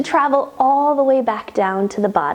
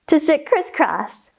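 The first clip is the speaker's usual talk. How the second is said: normal talking voice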